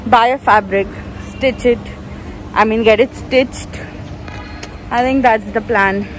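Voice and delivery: muffled through surgical mask; speaking behind mouth covering; through a face mask. Real, not acted. Seen 1: Disposable mask covers mouth and nose throughout; references show mask lowered.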